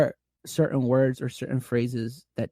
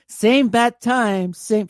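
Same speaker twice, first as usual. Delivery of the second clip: mock announcer; 10 semitones higher, 3 dB louder, much slower than usual. Mock catchphrase sign-off.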